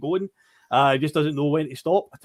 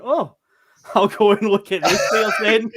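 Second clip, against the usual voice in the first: mock manager laughing; 5 semitones higher, 5 dB louder than usual. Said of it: "he's looked at that and went".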